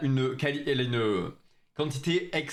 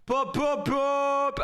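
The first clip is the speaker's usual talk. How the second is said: hype shout; 12 semitones higher, slower than usual. Real, not acted. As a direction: yell the shout-out like a streamer. Hype for new subscriber. (cri d'euphorie pour un nouvel abonné)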